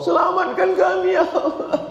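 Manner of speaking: high, wailing plea to God; mock tearful prayer, pitched very high; mock weeping plea. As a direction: ham up a pilgrim's tearful prayer.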